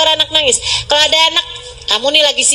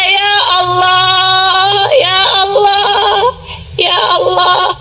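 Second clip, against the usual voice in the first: higher, drawn-out wailing plea; tearful pleading prayer; 4 semitones higher than usual. Acted. Preacher acting out a sincere night prayer, tearful pleading. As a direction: beg God through tears, stretching each call. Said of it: "kamu berdoa dengan linangan air mata".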